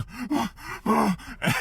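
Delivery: high, voiced gasps for air; acted heaving panic breaths; imitated panicked gasping. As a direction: gasp hard as if in shock. Panting as he hits the door.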